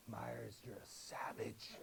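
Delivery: low, hushed imitation of stunned students; stunned kids' whisper; awed whisper. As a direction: whisper it slowly in mock awe. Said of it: "they just went like this".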